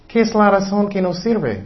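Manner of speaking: ordinary talk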